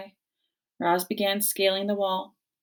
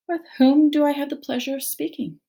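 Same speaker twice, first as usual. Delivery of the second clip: higher, extra polite robot; friendliest voice; 8 semitones higher, 4 dB louder than usual. Acted. Robot politely addressing the bickering bears, friendliest voice. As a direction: charm them with your politeness. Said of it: "the robot's friendliest voice".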